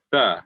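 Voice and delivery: "th" with tongue between the teeth; demonstrated TH sound. Shown as a demonstration. Teacher showing tongue position, deliberate TH sound. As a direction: put the tongue out slightly for "th". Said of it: "your tongue is between your teeth".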